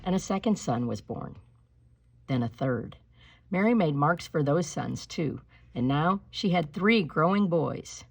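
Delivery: usual speaking voice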